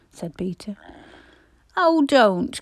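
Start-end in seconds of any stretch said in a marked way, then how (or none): 1.8-2.6 high, loud protest; crying out. A narrator's reading. Roberta begs her brother to stop, crying out. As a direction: cry out to stop the bickering. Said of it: "cried Roberta".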